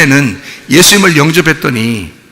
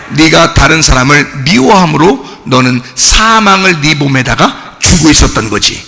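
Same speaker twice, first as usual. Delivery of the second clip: ordinary delivery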